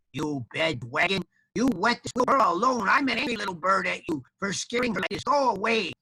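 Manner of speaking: high, scolding chick voice; feisty storybook chick; angry little bird. Acted. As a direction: tell off the dragon in a tiny bird's voice.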